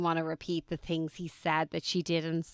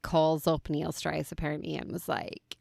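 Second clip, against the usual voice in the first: ordinary delivery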